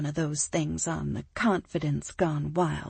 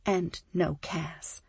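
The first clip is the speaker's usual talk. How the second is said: usual speaking voice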